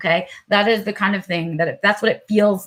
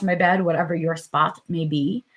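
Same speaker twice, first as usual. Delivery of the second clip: usual speaking voice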